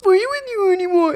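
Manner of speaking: high, loud fake-crying wail; mock tearful yelling. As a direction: yell it through put-on sobs. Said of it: "In the middle of, like, yelling".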